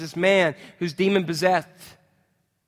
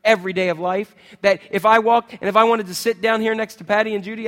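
ordinary talk

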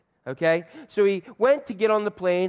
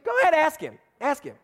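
put-on higher, insistent child's urging; pushy little girl; 11 semitones higher than usual. A girl pressing her seatmate to ask, higher and insistent. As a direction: nag him like an eager kid.

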